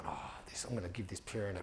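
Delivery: a quiet stewing mutter from a stung teacher; muttered fuming. Retold or imitated. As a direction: seethe under your breath at the screen. He's copped a serve, fuming.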